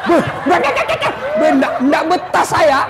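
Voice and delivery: high laughing "ndak, ndak"; laughing high protest. Retold or imitated. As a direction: squeal it out, laughing, like a fleeing jinn. Jinn fleeing the nagging wife.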